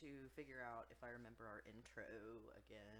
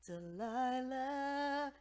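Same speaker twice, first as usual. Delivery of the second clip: slow, high, loud radio croon; Delilah voice; 10 semitones higher, 10 dB louder, much slower than usual. Acted. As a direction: croon her name like a late-night host. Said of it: "my Delilah voice".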